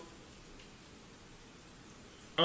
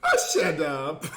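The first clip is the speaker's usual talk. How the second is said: laughing grumble; 5 semitones lower, slower than usual. Pretend.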